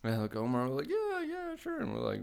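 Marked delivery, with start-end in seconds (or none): 0.9-1.8 fake agreement. Insincere agreement to homeless man.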